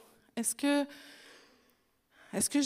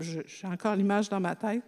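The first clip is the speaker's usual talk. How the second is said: normal talking voice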